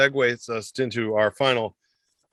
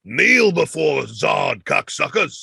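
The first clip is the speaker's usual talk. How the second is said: loud, slow, commanding comic impression; stretched-out mock command; Joey Diaz; 3 semitones higher, 4 dB louder, slower than usual. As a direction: bellow it like a brash comic, drawn out. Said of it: "Joey Diaz, he goes".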